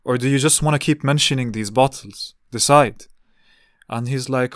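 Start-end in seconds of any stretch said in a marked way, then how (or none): 0.0-3.0 louder, pointed ultimatum; firm retort. Retold or imitated. Telling the uncle to choose, firm and louder. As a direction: put him on the spot. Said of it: "then I told him".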